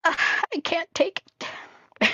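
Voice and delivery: high, slow mock wail; voicing herself in pain. Voicing the cat's toenails digging in, high mock wail. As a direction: whine it high and slow like it hurts. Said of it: "and it's like".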